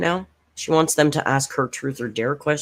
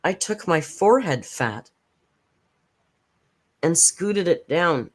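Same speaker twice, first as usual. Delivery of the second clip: slow, deliberate reading of "scooted"; slow deadpan quote; 3 semitones higher than usual. Retold or imitated. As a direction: savour each word of the quote, slowly. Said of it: "I had to write that down".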